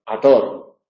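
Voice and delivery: actor said as ator, k left out; dropped k. Shown as a demonstration. Teacher showing the elided k pronunciation. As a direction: drop the k of actor entirely. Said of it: "otra consecuencia es la eliminación".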